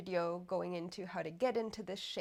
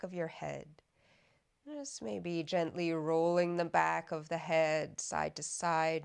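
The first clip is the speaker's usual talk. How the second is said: guided meditation; 4 dB louder than usual. Acted. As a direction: guide them unhurriedly into softening, evenly and calmly. Leads a body scan meditation.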